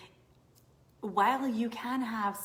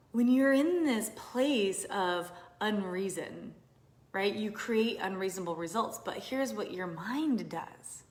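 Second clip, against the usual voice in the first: usual speaking voice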